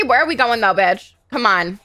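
ordinary delivery

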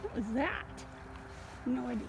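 ordinary delivery

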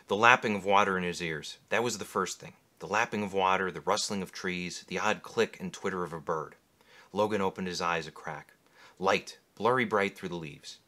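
low, measured reading of a novel; story narration. A narrator's reading. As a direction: read it like an audiobook narrator. Reads novel excerpt aloud.

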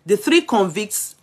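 a touch of newscaster; news read. Acted. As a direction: read the headline out to the viewers. Reads news report aloud.

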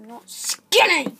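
loud, drawn-out protest; indignant retort. Acted. Horse answers being called skinny, indignant shout. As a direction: burst out with the stressed word stretched.

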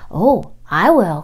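loud, slow quacking duck. Acted. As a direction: quack it out eagerly. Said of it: "quacked the duck".

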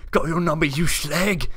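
mock taunt. Player echoing a teammate's taunt, loudly jeering. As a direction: throw it out as a loud mock insult.

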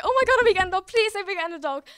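put-on a child begging her parents, beside herself with excitement; over the moon. Pleading to her parents for a dog, breathless and squealing. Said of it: "By now I'm, like, so excited".

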